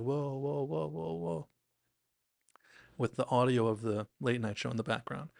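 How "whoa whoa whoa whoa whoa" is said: imitated whoa-ing. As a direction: mimic someone reeling in a fish. Retold reaction while reeling in fish.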